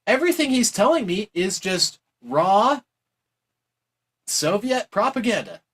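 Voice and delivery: high, excited mocking paraphrase; mock Thatcher gushing. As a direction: gush like a dazzled fan. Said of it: "openly admitting that, like".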